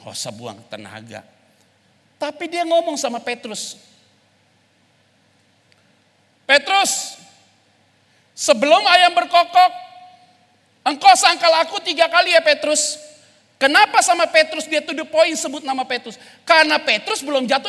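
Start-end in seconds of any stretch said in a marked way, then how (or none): 2.2-3.8 loud, high preaching at full force; fiery preaching. Acted. 6.5-13.0 loud, high solemn warning; Jesus warns Peter, loud and high. Acted.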